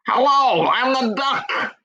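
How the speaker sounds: high cartoon duck saying hello; silly duck voice. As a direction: squawk it out like a cheerful toy duck. Recording a voice for duck.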